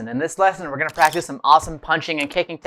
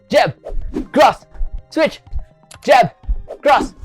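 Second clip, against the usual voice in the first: loud, higher calls; shouted drill commands; 7 semitones higher, 3 dB louder, slower than usual. Shown as a demonstration. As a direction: bark out each strike. Calling out punch combo.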